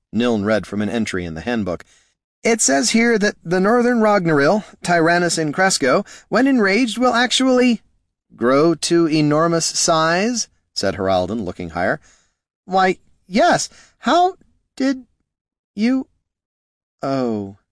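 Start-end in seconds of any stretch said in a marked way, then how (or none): 2.4-7.8 high, anxious reading aloud; high-pitched nervous recitation; alarmed reading. Acted. Reading dire handbook entry aloud. 8.3-10.5 slow, high, stunned question; drawn-out, high incredulous guess; dawning disbelief. Acted. Bard looks up at giant creature. 12.6-17.6 very high, halting, then dropping; squeaky halting surprise, falling to a small oh; dawning realisation. Acted. Priest realises monster looms overhead.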